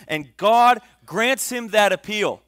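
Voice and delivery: loud, high, rising sermon delivery; full-force preaching. Acted. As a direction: preach it out to the back row.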